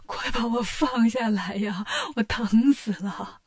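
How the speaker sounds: slow, wailing plea; crying. Acted. Tang Seng crying slowly to be let down. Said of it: "唐僧哭着说".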